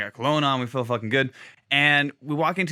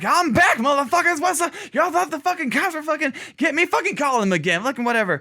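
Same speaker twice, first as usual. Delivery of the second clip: mock defiant holler; 11 semitones higher than usual. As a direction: announce his return brashly, easing off by the end. Retold hollering man returns, taunting staff.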